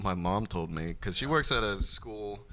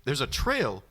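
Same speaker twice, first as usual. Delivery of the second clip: higher, rising disbelief; mock amazement; 10 semitones higher than usual. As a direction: act out a stunned discovery. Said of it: "she goes".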